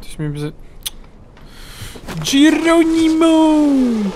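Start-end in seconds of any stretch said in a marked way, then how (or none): 2.2-4.1 long high yell of Geronimo; battle cry. Real, not acted. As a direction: yell it as you leap.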